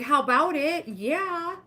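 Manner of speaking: high, loud and thrilled reply; eager yes. Retold or imitated. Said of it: "And I was like".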